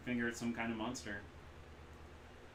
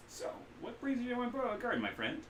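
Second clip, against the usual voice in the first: cheerful street vendor; 14 semitones higher than usual. Acted. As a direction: sell it like a pushy vendor. Kaida voice again.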